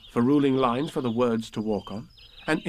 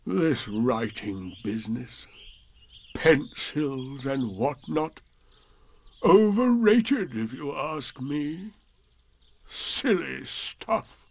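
slow, drawn-out disgruntled donkey; dragged-out sour Eeyore; grumbling to himself; 2 semitones higher, slower than usual. A narrator's reading. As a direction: grumble dismissively as if to yourself. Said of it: "Eeyore was saying to himself".

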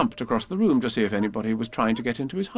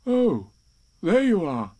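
high, rounded Pooh voice; 6 semitones higher, slower than usual. A narrator's reading. Pooh locates the unseen animal, high storybook bear voice. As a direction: find the visitor at last, high-pitched. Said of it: "said Pooh".

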